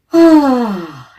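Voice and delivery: big open yawn, loud; demo yawn. Shown as a demonstration. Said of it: "Do a couple yawns".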